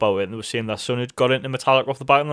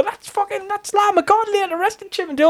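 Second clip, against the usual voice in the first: much higher gushing to others; excited telling. Retold or imitated. Fan telling people about the song, much higher excited gush. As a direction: gush to bemused people nearby.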